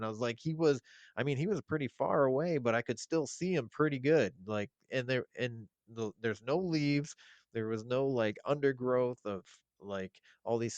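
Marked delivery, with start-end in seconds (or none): 0.4-4.3 insistent retelling. Retold or imitated. Insisting in retold argument.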